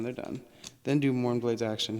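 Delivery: usual speaking voice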